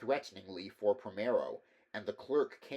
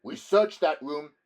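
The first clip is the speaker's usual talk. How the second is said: higher, louder scoffing reply; dismissive; 6 semitones higher, 12 dB louder than usual. Acted. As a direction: brush him off.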